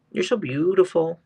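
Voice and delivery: high tender praise; cooing. Real, not acted. Owner praising her husky, high and tender. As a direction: coo it sweetly to the dog.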